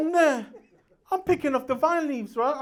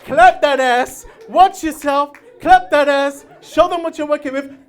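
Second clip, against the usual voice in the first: loud cheering; 3 semitones higher, 11 dB louder than usual. Retold or imitated. Mum cheering him on.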